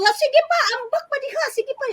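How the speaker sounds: put-on high, sarcastic telling-off of a child; mock scolding. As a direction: dare the child to keep going, hammed up. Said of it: "pag pinagalitan mo".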